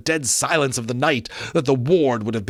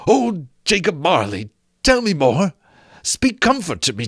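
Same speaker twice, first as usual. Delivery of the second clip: higher, louder begging; imploring; 7 semitones higher, 4 dB louder than usual. Acted. Scrooge imploring Marley's ghost, higher pleading. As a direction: plead for comfort. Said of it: "he said imploringly".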